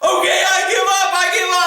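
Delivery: high-pitched retold plea; mock crying surrender. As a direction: imitate a man tearfully surrendering. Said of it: "and he starts crying".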